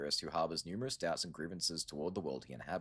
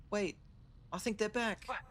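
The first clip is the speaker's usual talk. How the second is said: much higher put-on girl's voice; high girlish voice. Shun Shun relieved Yi is awake, high girlish voice. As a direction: lift way up into a young girl's voice.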